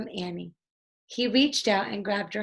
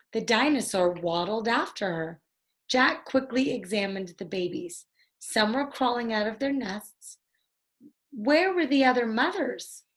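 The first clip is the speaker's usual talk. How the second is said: ordinary delivery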